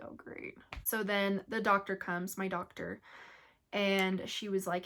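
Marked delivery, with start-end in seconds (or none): 0.0-0.6 whispered "Oh, great" in dry sarcasm; a wry unvoiced aside; sarcastic whisper. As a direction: breathe it out, deadpan sarcastic. Sarcastic reaction, husband just left.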